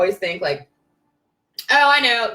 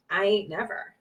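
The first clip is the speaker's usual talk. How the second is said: fading to a whisper; 4 semitones lower, 9 dB quieter than usual. Real, not acted. Repeated never fading to breath.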